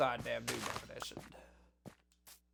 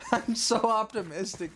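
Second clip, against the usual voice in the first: high, laughing self-mockery; 6 semitones higher, 3 dB louder than usual. Real, not acted. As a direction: laugh at your own optimism. Joking about the coming trouble.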